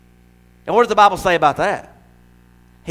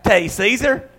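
ordinary talk, nothing marked

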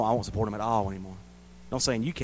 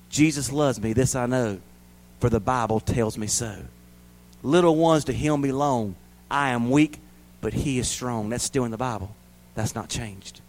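usual speaking voice

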